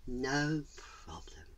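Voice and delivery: slow, soft whispered answer; drawn-out hushed reply; whispering spirit. Acted.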